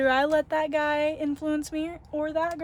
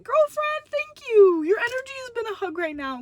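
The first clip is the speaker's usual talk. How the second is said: gushing thanks; 9 semitones higher, 4 dB louder than usual. Retold or imitated. Retold gushing thanks to employee.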